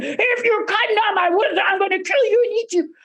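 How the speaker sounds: high screamed troll threat; roaring troll. A narrator's reading. Huge troll threatens second brother, screaming and roaring. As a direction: roar it out as an angry troll. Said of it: "screaming and roaring".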